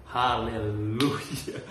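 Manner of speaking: diulur sangat lambat; pasrah kocak. Retold or imitated. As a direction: seruan pasrah yang dipanjangkan untuk melucu. Comic reaction to the shocking news. (haleluya lambat, pasrah jenaka)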